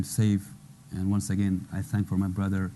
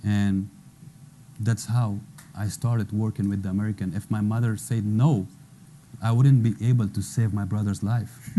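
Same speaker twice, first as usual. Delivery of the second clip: normal talking voice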